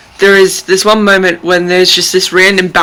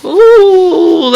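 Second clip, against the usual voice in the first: stricken oh; 10 semitones higher, 6 dB louder than usual. Real, not acted. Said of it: "that got me".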